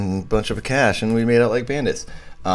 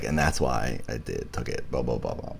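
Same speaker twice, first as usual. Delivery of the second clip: mumbled quote; 9 semitones lower, 10 dB quieter than usual. Retold or imitated. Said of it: "she's like".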